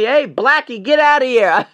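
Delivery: loud, high imitated jeer; mock heckling shout. Heckler yelling at him, retold as a mock shout. Acting out people's insulting shouts.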